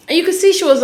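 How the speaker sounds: usual speaking voice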